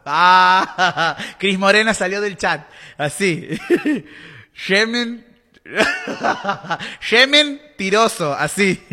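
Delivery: loud laughter through the words; laughing hard. Real, not acted. Laughing loudly at her own joke about the chat.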